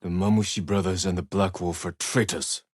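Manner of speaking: ordinary delivery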